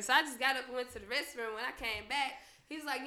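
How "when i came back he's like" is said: ordinary talk, nothing marked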